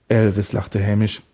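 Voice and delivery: ordinary delivery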